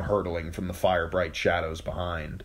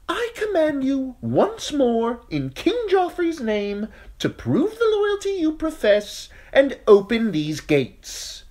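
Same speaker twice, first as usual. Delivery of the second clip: high, slow, commanding knight; high thin voice; 7 dB louder than usual. Acted. Sir Amory commands again.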